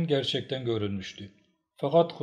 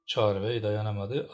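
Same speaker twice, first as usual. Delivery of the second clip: ordinary delivery, nothing marked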